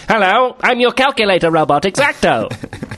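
high, polite robot butler. Pretend. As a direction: play a chirpy robot butler. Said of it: "sounds like a robot that's got a personality".

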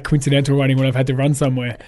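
ordinary talk, nothing marked

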